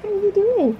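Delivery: high sing-song voice for a pet. Real, not acted. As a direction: coo at the little pet. Cooing at pet guinea pig.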